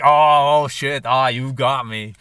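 mock-defeated, drawn-out loud "oh"; sarcastic. Barman answering the French girl's jab, sarcastically. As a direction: act got, sarcastically, opening loud. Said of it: "you know, sarcastically".

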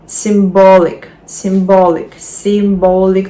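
"symbolic" with stress on BOL; stress before -ic. Shown as a demonstration. Teacher drilling three syllables, stress on BOL in "symbolic". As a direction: hit sym-BOL-ic firmly. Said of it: "наголос так само буде перед цим закінченням".

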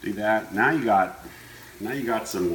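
ordinary delivery

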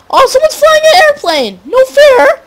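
high, loud indignant yelling; excited shout. Real, not acted. Sees plane, whines unfairness.

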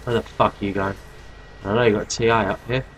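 normal talking voice

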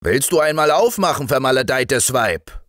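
high, fast angry demand; raging, cursing. A narrator's reading. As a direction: curse impatiently at a shut door. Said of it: "und tobte und fluchte". (tobend und fluchend)

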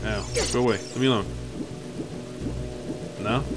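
ordinary talk

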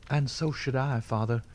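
lightly voiced spirited daughter. A narrator's reading. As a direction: answer your father with spirit. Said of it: "she answered with spirit".

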